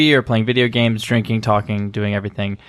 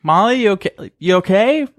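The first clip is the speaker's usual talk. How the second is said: high, gentle worried asking; concerned check-in; 7 semitones higher than usual. Retold or imitated. Roommate retelling knocking on upset friend's door, high concerned asking. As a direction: coax her through the door.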